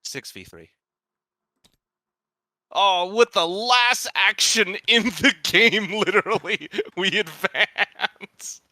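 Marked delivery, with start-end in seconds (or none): 2.7-8.6 excited laughing. Real, not acted. Last action wins.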